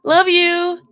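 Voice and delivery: high, loud, bright chirp. A narrator's reading. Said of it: "voice bright and pitched higher than usual".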